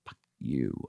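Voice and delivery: low, hushed curse to himself; retold private curse. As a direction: curse the station under your voice. Retells private vow at station sign.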